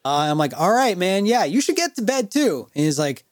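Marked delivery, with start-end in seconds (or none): 0.6-2.7 humoring tone. Retold or imitated. Humoring drunk friend.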